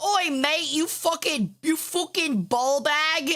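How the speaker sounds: slow, lightly put-on British lad swearing; mild mock British rant; British bloke insult. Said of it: "a British guy being like".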